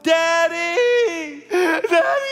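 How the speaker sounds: very high, loud drawn-out cry; retold child's scream. Small son crying out to dad during stitches, screaming. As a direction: scream out like a terrified child calling for help. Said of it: "Just screamed".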